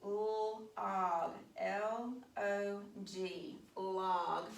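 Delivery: "L O G" spelled slowly, letter by letter; teacher spelling the word with the class. Shown as a demonstration. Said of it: "y'all help me spell it".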